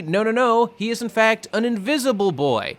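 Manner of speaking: high-pitched, hyped-up announcement; mock-heroic hype, pitched well up; dramatic reveal. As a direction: proclaim it like a superhero introduction. Dramatic hyped retelling of hero's reveal.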